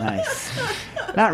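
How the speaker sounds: laughing through the words; chuckling while he speaks. Real, not acted. Host laughing at the guest's accent.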